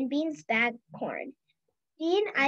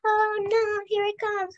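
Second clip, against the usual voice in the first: holler; 7 semitones higher than usual. A narrator's reading. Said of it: "hollered Prairie".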